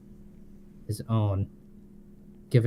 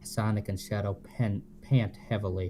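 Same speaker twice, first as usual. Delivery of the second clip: ordinary delivery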